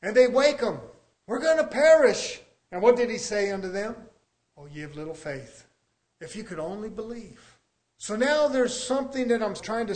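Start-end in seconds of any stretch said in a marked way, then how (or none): 1.3-2.4 raised, louder acted plea; mock alarmed cry. Disciples waking Jesus in the storm, acted alarm. 4.6-7.6 much softer, calm acted reply; hushed, disappointed quote; gentle reproof. Jesus answering frightened disciples, soft reproof.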